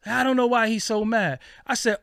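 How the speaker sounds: ordinary talk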